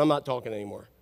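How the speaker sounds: low, soft, withdrawn grumble; mock sulky refusal. Imagined God sitting back from us, quietly refusing.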